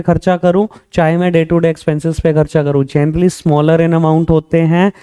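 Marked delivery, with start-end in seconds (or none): 2.8-4.9 slow emphasis. Shown as a demonstration. Teacher stressing key definition slowly.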